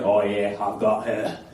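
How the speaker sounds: deep mock Australian accent; Steve Irwin. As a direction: drop low for a broad, blokey Australian. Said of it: "but I can't do that voice".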